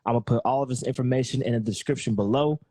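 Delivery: ordinary talk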